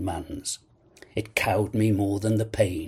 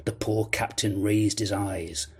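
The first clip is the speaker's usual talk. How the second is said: plain reading voice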